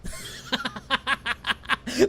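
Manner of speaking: high cackle; barked laugh. A narrator's reading. Old woman laughing at the fleeing boys, high cackle. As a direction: bark a laugh at them. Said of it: "barked out a single laugh".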